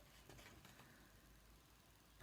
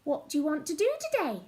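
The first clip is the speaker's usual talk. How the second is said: fast eager question; 4 dB louder, much faster than usual. Acted. Piggie asks eagerly.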